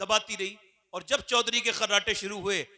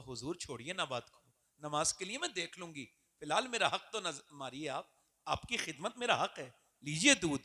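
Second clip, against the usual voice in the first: softly coaxing, much softer, like a devoted daughter; lovingly; 4 semitones lower, 7 dB quieter than usual. Acted. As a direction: gently persuade him to take the milk. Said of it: "बड़े प्यार से बातें की".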